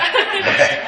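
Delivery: a real, sudden laugh at the joke; loud burst of laughter. Real, not acted. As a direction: laugh out loud, high and bright.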